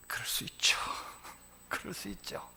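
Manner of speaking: breathy whisper with a chuckle; hushed, understanding whisper with a soft laugh; whispered aside. Real, not acted. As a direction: lean in and whisper it kindly. Sympathetic aside to congregation.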